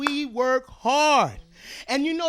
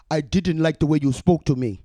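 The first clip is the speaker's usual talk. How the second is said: deep husband voice; 9 semitones lower, 4 dB louder than usual. Retold or imitated.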